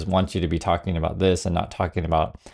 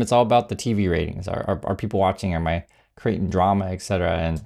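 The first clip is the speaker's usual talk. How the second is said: ordinary talk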